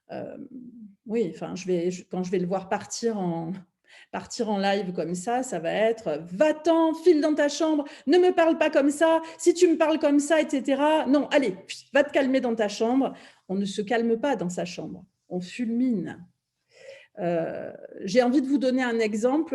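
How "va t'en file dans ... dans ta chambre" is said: loud, high-pitched angry scolding; angry parent. Acted. Parent snapping at a child having a meltdown, angry. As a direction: send the child off in raised-voice anger. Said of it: "Ça va être aussi quand même dans la colère".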